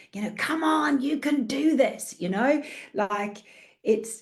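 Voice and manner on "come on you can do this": higher-pitched rallying cry; cheerleader. Acted. Coach describing herself cheering clients on from behind, cheerleader voice. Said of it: "the cheerleader behind".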